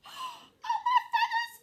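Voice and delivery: very high, panicked chick squeak; tiny frightened chick voice. A narrator's reading. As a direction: squeak it out in alarm. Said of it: "he squeaked".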